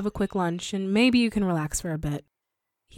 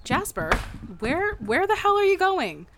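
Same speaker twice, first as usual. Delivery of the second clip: surprised calling out; 9 semitones higher than usual. Acted. He rushes out.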